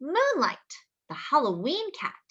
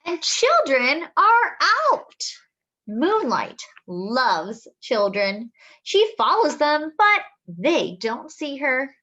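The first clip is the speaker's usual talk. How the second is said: storybook reading; 2 semitones higher, 7 dB louder, slower than usual. Acted. Reading a picture book to children, lively and slow. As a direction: read it out like a treat for the kids.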